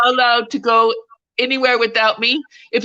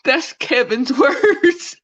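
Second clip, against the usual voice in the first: laughing; 3 semitones higher, much slower than usual. Real, not acted. Laughing at the son's swearing.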